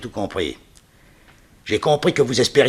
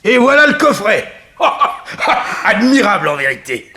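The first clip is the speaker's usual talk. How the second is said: high, loud gloating laugh through the words; villain laughing in triumph as he finds the coffret; triumphant laughter; 11 semitones higher, 9 dB louder than usual. Acted. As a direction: cackle with glee over the treasure. Villain finds the treasure box.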